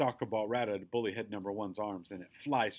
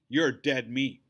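loud, menacing bully snarl; snarled threat; 2 semitones higher, 7 dB louder, slower than usual. A narrator's reading. Second bully threatening the girl, snarling. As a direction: snarl it at your victim. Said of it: "snarls bully head number two".

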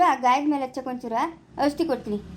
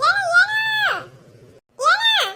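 shrill, much higher shout of alarm; 5 dB louder, much slower than usual. Acted. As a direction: yell out startled, much higher and louder. Alarmed reaction in family scene.

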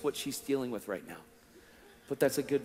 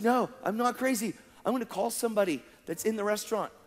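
insistent protest; 7 semitones higher than usual. Retold or imitated. Calls back to hysterical waitress.

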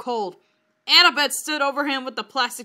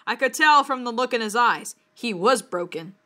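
ordinary delivery

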